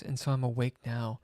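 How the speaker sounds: ordinary delivery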